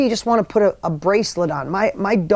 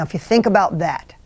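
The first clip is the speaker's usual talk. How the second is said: normal talking voice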